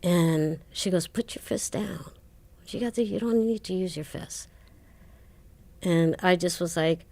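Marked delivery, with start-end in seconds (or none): 1.1-4.5 hushed, gently retold warning; a hint of a quiet nun calming a girl; softly quoted nun. Retells nun calming her down.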